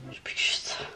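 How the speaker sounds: hushed whisper to oneself. Real, not acted. Sewer to herself over the seam, whispered. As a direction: whisper it under your breath while concentrating. Talking to herself while sewing.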